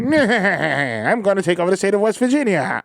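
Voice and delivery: slow, high hammy cartoon villain. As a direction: ham up a cartoon baddie, slow. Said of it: "sound like Dick Dastardly".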